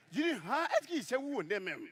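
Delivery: usual speaking voice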